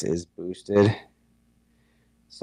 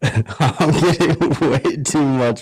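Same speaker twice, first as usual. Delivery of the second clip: laughing; 3 semitones higher, 5 dB louder than usual. Real, not acted. Laughing at chaotic mixed chat.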